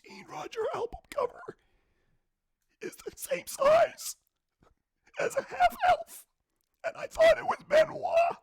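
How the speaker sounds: high strained wail rising loud; creepy creature voice. Acted. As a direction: build from whisper to shout. Squiddy's confession.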